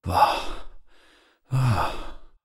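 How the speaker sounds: high airy "واه" after each sip; relish sigh. A narrator's reading. («واه» با لذت و صدای بالا)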